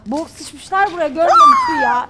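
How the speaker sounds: loud, high, disgusted; disgusted shout. Real, not acted. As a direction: yell it in revulsion. Disgusted outburst in ruin.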